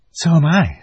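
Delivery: a lightly voiced older girl; Susan agreeing. A narrator's reading. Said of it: "said Susan".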